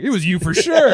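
high, loud finger-pointing; mock accusation. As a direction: pin the blame on someone, hammed up. Falsely blaming man in crowd.

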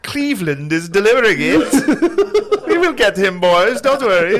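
high, slow, loud, laughing; rally the boys through giggles; mock Nazi captain. Nazi captain rallies his crew, high, loud and laughing.

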